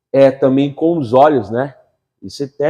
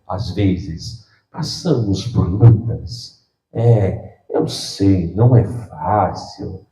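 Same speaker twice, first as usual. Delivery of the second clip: low, slow, softer mournful croon; mock gloomy gospel; 7 semitones lower, 4 dB quieter, much slower than usual. Preacher parodying a gloomy gospel singer, low and slow. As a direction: parody a sad worship intro. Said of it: "música deprê gospel".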